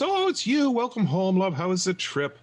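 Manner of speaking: high, bright-eyed wife greeting her husband; a cheery wife's welcome, pitched high; sweet wife. Acted.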